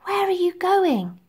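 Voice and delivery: put-on high little-child voice, not truly whispered; lightly voiced littlest orphan; whispering little girl. A narrator's reading. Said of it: "Nancy whispered".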